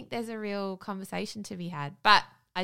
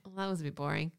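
low, soft deadpan verdict; unimpressed son; 4 semitones lower, 6 dB quieter, faster than usual. Retold or imitated. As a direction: imitate a child who is not impressed. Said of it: "our son just went".

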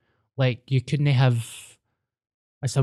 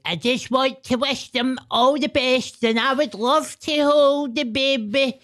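high put-on voice of an oddball fan; squeaky mock well-wisher, much higher; imitating the oddball outside; 13 semitones higher than usual. Friend mocks oddball outside the palace in high mock voice. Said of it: "there was a guy outside, like".

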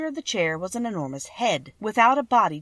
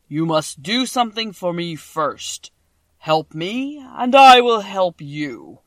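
voice of the great Oz; 9 dB louder than usual. A narrator's reading. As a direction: boom each word out like a huge magic head.

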